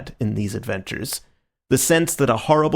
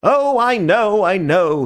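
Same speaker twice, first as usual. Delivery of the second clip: reckless dismissal; 9 semitones higher, 6 dB louder, slower than usual. A narrator's reading. Marquis brushing off reckless.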